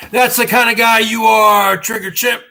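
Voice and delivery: loud, scornful callout; angry shout. Real, not acted. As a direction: yell it at him in disgust. Yelling at the stingy customer.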